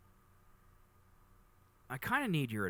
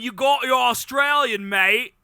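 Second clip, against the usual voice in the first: high, loud put-on Australian; exaggerated Australian caricature, belted out; Australian accent; 9 semitones higher, 10 dB louder, slower than usual. Shown as a demonstration. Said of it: "there's different British accents".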